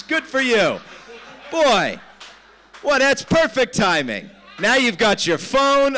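loud, high mock congratulations; sarcastic outburst. Real, not acted. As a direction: cheer them on with exasperated sarcasm. Sarcastic outburst at distracted audience member.

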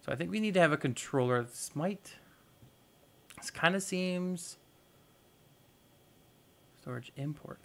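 ordinary talk, nothing marked

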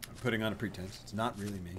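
ordinary talk

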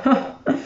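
short unvoiced chuckle; a quick breathy laugh at the scare. A narrator's reading. Genie laughing at the frightened fisherman, short breathy chuckle. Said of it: "Il genio scoppiò a ridere".

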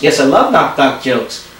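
ordinary talk

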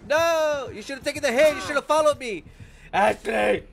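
frustrated yelling. Real, not acted. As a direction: yell in frustration.

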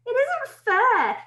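high, slow, indignant schoolgirl; complaining girl. Acted. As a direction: protest like a wronged girl. Astrid complains about unfairness.

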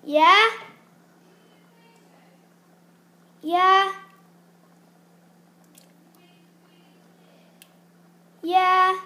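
questioning shout; calling out. Real, not acted. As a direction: call back across the house. Calling back to someone away.

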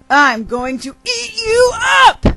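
high, loud predator's threat; gleeful menacing shout. Acted. Bird threatens to eat the worm.

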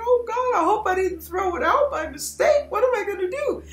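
high, loud, near-tearful mock panic; hammed-up frantic wail over a lost ring; panicking. Owner searching drawers for the ring, tearful high panic. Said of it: "You're panicking".

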